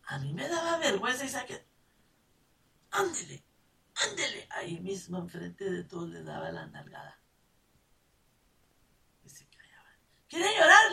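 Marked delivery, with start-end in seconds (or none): 2.9-4.5 sharp high scolding warning; mother warning misbehaving sons; stern mother. Retold or imitated. 10.3-10.9 high, loud taunting question; mother daring her crying kids; mocking threat.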